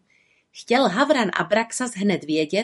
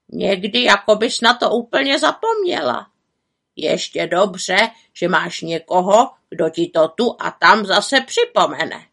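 scolding raven; 4 dB louder than usual. A narrator's reading. Raven scolds the witch for neglecting herself, exasperated. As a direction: lecture her like a sensible raven.

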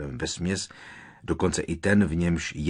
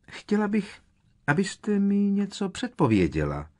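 high, deliberately separated words; very distinct; 14 semitones higher, 4 dB louder than usual. A narrator's reading. Professor repeating her request to the stunned seer, very distinctly. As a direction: repeat it pointedly, each word clear. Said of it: "opakovala velice zřetelně".

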